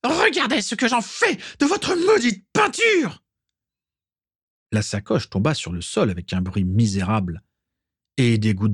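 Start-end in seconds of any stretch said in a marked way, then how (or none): 0.0-3.2 high, scornful witch's shriek; mock him in a rage; spiteful shriek. Walburga mocking the painter's ruined paint, high spiteful shriek. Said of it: "cria Walburga".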